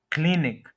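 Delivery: Clinic as "KLIN-ik", first syllable strong; correct first-syllable stress. Shown as a demonstration. Teacher modelling the word for learners, stressed pronunciation. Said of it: "first के ऊपर stress है".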